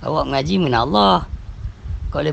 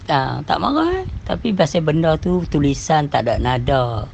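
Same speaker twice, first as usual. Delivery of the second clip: ordinary delivery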